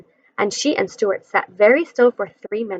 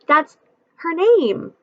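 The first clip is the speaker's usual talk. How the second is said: ordinary delivery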